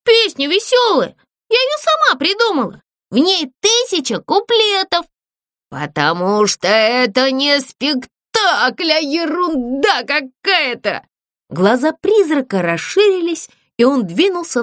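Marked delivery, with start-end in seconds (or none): 0.0-5.1 shy little puppet turning her back; high puppet voice. Acted. Puppet tells the ghost he embarrasses her, high and coy. Zlyunya defends her song. 5.7-11.0 slow scornful ghost voice; dismissive ghost. Acted. Ghost tells the puppet her show is nonsense.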